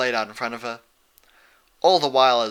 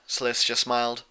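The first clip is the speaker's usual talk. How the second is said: usual speaking voice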